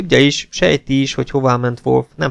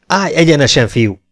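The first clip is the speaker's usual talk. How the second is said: high, loud drill-sergeant order; roared command; 5 semitones higher, 5 dB louder than usual. A narrator's reading. Jakes scolds the prisoner for slackening, roaring. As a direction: bellow it at a slouching recruit. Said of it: "üvöltötte Jakes".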